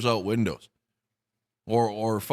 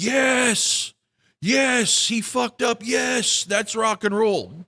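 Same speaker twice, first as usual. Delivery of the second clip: high, loud jeering cheer at a flubbed note; mock cheering fan; 12 semitones higher, 5 dB louder, much slower than usual. Ranter mocks fans who cheer at mistakes, high loud jeer. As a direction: ham up a fan gloating over the mistake.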